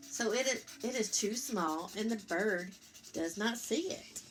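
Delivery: gentle picture-book read-aloud; reading to children. A narrator's reading. Parent reading Eric Carle to Lucas, gentle storytelling.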